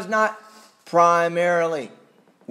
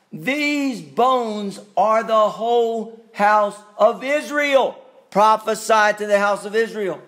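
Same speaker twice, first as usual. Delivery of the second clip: higher, louder, slow fervent proclamation; preaching at full force; 7 semitones higher, 5 dB louder, slower than usual. Acted. Preacher proclaims the verse to his listeners, preaching at full force. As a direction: thunder it out to the congregation.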